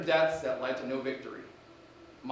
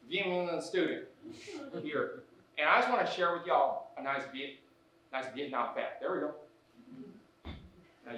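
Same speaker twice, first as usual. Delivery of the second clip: hammed-up Southern principal; 2 semitones higher, 8 dB louder than usual. Principal character held through sketch.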